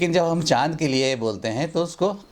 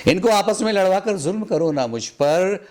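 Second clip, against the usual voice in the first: repeated line sung out higher with feeling; raised recital; 6 semitones higher than usual. Acted. Poet repeating his plea at a mushaira, pitched higher. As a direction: lift the whole line higher on the repeat, pleading.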